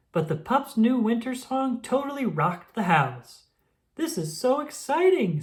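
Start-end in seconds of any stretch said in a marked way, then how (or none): 3.9-5.4 much higher, excited squeal; thrilled exclamation; exclaimed. Acted. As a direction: bounce with delight. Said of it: "Skye exclaimed".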